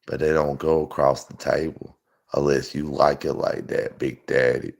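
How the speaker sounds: low, soft leering invitation; imitating a sleazy camper man; creepy camper guy. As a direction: coax them into the camper, low and sly. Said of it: "That's what them motherfuckers, people in the camper say".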